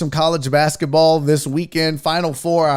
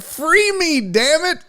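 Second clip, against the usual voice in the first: a higher, hammed-up plea; lightly acted cry to be freed; mock pleading; 9 semitones higher, slower than usual. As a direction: play the donor begging to be let out. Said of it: "K D sent me five dollars and said".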